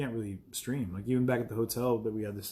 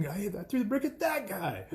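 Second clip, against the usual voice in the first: high squeaky mock yell; 10 semitones higher than usual. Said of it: "repeat of quote".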